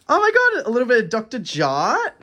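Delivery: high, loud delighted squeal; excited squeal. Real, not acted. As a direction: shriek with joy at the gift. Excited over skincare gift.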